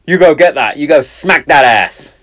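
loud whoop of mock support; mock cheering. Dad at a video, loud mock cheer. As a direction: cheer her on like a proud fan.

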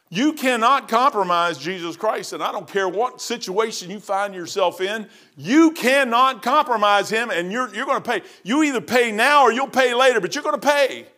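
slow, rising preacher's warning; preaching. Acted. Preacher warning the congregation, fervent. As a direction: drive the warning home to the church.

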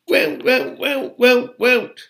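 high, loud elf croaking; continued croaks. A narrator's reading. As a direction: keep croaking shrilly over everyone. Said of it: "Creature's continued croaks".

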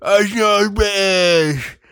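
mocking how Brits talk; mock accent. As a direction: stretch it out like a garbled Brit. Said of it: "you don't go".

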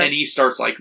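ordinary talk

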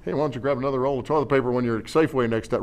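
a casual inner nudge, rushed and soft; quick aside. Retold or imitated. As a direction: toss it off quickly, like a passing thought. Spirit of God voiced.

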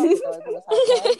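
high, quick laughing aside; giggling through the inside joke. Real, not acted. Laughing as she tells friends about the campus clock.

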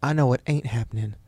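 normal talking voice

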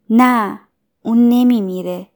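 ordinary delivery, nothing marked

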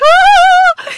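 very high, loud imitated wail; mock crying wail. Friend crying outside the cemetery gate, mock wail. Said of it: "And Kirsten's crying. She's like".

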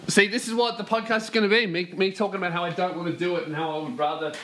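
ordinary talk, nothing marked

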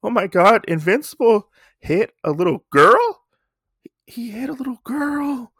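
high scandalised gasps; shocked gasping crowd. Retold or imitated. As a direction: gasp in disbelief as bystanders. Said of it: "the people in the background are like".

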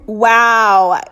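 breaks into a laugh at her own line; self-amused chuckle; laughing at herself. Real, not acted. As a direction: laugh at how wise that sounded. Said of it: "Someone call me fucking Aristotle".

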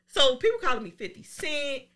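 ordinary talk

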